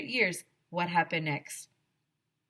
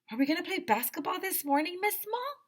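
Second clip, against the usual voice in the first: put-on eager child question; 12 semitones higher, faster than usual. Child asks teacher excitedly.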